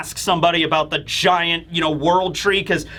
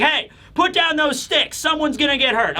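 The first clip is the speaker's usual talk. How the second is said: higher-pitched mock parental warning; scolding parent; 8 semitones higher than usual. Parents breaking up backyard sword fight, scolding. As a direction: scold the kids from across the yard.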